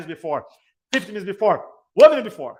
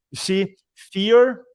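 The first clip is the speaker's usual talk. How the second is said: slow, loud punch on fear; preacher's stress; emphatic build; 4 semitones higher, 7 dB louder, much slower than usual. Acted. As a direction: drive each word home.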